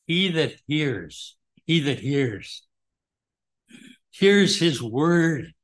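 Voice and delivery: "hears" drawn out slow and weighty; slow emphasis. Shown as a demonstration. Preacher lingering on hearing the word, slow and emphatic. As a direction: dwell on every word of "He that hears". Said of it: "been an emphasis on that".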